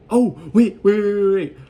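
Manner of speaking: loud, high burst of wait wait wait; frantic self-interruption; excited interruption. Real, not acted. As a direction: stop yourself mid-sentence, suddenly excited. Suddenly remembers a plot detail.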